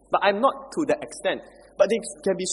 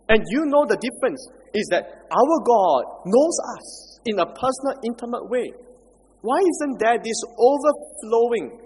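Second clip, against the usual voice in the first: impassioned preaching; 5 semitones higher, 4 dB louder than usual. Acted. Preaching at full force.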